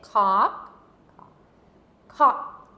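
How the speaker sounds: "cock" loud, short, stopped in the throat; fast throaty cock. Shown as a demonstration. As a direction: bark "cock" out quickly from the back of the throat. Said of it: "I'll say it and then you say it".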